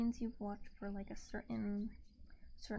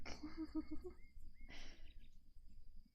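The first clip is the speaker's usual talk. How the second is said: soft breathy laugh; 7 semitones higher, 5 dB quieter than usual. Real, not acted. Laughs at herself.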